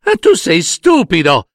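high, scornful wife's outburst; angry cry. A narrator's reading. Wife calls her husband stupid, high angry cry. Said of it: "gridò sua moglie".

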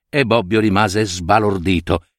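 ordinary delivery

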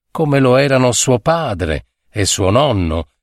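plain reading voice

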